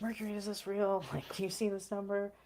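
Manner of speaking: breathless wonder, voice lifted high; excited disbelief. Retold or imitated. Asks roommate about surprise money.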